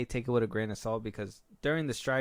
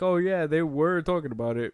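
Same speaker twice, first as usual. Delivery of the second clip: mock insider claim; 5 semitones higher, 6 dB louder than usual. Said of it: "That's easy to be like".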